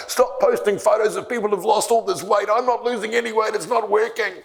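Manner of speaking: high, whiny mock complaint; grumpy complaining. Grumpy dieter griping to his coaches, high and whiny. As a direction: whine it like a sulky dieter. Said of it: "I would be so grumpy, and I would complain".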